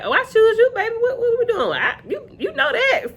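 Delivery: very high, gushing mock squeal; playful high-pitched gush; excited acceptance. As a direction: imitate an eager, instant yes in a high voice. Acts out her eager acceptance.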